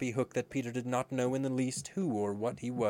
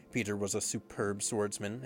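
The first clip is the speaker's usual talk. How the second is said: usual speaking voice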